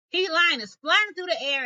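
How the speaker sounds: ordinary delivery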